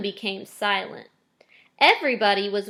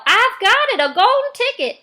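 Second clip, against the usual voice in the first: much higher, loud triumphant shout; excited yell; 13 semitones higher, 9 dB louder than usual. Retold or imitated. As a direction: call it out like you just struck gold. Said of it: "one of my workers yelled".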